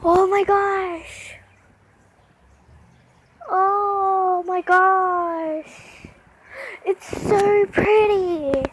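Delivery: slow, drawn-out awe; awed. Real, not acted. Vlogger watching the eagle fly, slow awed murmur. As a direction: breathe it out in wonder.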